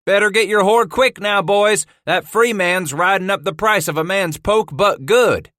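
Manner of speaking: yelling. Acted. Trapper yells to others.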